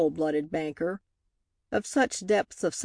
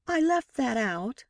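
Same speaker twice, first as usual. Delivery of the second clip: higher, sweetly innocent tone; 6 semitones higher than usual. A narrator's reading. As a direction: say it sweetly, pitched up. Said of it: "she said sweetly".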